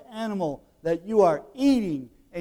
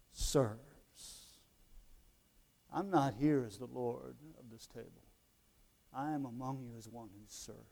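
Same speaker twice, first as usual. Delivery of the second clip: low, very soft, with long pauses; humble, gentle Jesus; 7 semitones lower, 11 dB quieter than usual. Acted. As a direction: let it settle into quiet humility. Voicing Jesus as humble servant.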